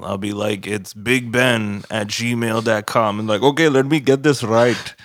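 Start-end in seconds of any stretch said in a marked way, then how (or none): 0.7-3.2 slow, careful spelling; slow spelled out. Retold or imitated. Spelling out email slowly. 3.4-4.9 put-on Indian accent. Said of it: "the thickest Indian accent".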